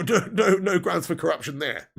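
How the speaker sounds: ordinary talk, nothing marked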